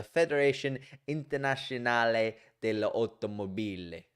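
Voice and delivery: Federazione Internazionale dell'Automobile rolled in a hammed-up Italian accent; a playful Italian accent on the full name. Shown as a demonstration. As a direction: give it a showy Italian accent. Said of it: "I said it in Italian accent".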